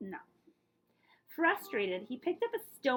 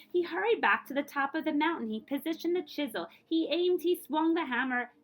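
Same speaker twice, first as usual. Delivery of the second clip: plain reading voice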